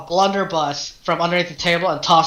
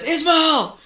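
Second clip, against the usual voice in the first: much higher call; 11 semitones higher than usual. Acted. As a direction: call across the room to the priest. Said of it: "he says".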